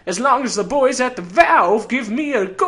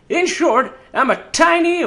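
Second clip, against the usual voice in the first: usual speaking voice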